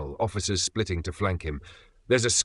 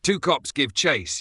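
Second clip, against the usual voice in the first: usual speaking voice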